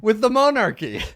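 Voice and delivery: high, loud stand-up comic impression; Seinfeld. Joking about the jester as observational comic, loud Seinfeld impression. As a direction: belt out an observational comedy opener. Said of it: "she's the Seinfeld of the generation".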